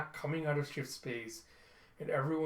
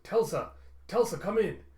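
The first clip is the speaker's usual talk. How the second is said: raised, higher urgent call; 6 semitones higher, 7 dB louder than usual. Retold or imitated. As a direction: call out for someone not answering. Commander calling over comms.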